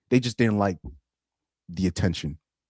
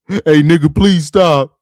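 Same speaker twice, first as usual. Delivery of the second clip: higher, louder plea of someone getting hit; imitating a real voice coming out; 7 semitones higher, 9 dB louder, slower than usual. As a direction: blurt it out like he's being beaten up. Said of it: "their real voice start coming out".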